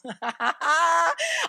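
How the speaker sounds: high, real laugh mid-sentence. Real, not acted. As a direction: burst out laughing at the story. Laughs while telling the story.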